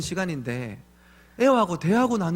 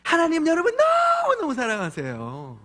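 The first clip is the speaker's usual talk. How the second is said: much higher, sing-song sweet voice, 너무너무 stretched; kindergarten preacher voice; 13 semitones higher than usual. Retold or imitated. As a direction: coo it to little children. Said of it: "유치부를 부르면". (유치부 아이들에게 하는 달콤한 목소리)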